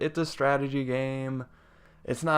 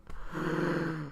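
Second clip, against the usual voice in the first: heavy sigh; 3 semitones higher, 10 dB quieter than usual. Real, not acted. After mourning soldier.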